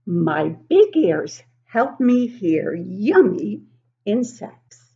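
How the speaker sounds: a proud little fox showing off its big ears; fennec fox voice. Acted. Reading a picture book to kids as the fox, slow and loud. Reads fennec fox's lines.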